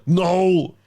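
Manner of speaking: loud, high mock cry of no; Arnie. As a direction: action hero's drawn-out wail as the trap misses. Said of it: "that's my Arnie".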